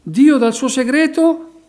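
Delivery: loud, slow, impassioned sermon delivery; preaching at full force. Acted. Preacher urging his congregation, in a loud, slow, fervent preaching voice. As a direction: drive each phrase home like a revival preacher.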